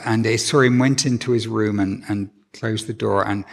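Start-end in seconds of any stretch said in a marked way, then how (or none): none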